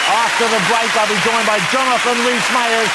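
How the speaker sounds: loud, high, upbeat presenter sign-off; big showbiz link to the break; TV host announcement. Acted.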